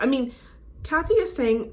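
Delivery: usual speaking voice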